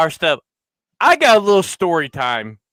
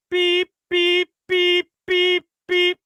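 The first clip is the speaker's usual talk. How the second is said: high mock reversing beeps; backing-up beeps; 12 semitones higher, slower than usual. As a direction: beep like a cart backing up. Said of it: "I start doing beep".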